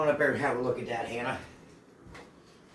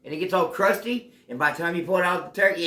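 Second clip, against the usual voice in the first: ordinary delivery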